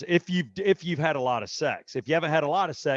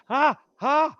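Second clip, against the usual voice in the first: high, loud mock yelps of pain; 11 semitones higher, 6 dB louder than usual. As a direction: yelp like it burns. Retold burning while peeing.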